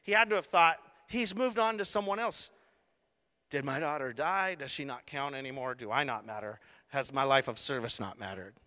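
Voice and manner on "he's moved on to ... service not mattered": quiet hurt doubt. Retold or imitated. Jairus' imagined inner doubts.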